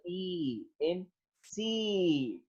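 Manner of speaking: E and seat with a drawn-out ee vowel, softly; long E. Shown as a demonstration. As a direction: stretch the E alone, then hold the same vowel in seat. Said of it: "ई sound जो है वो longer one".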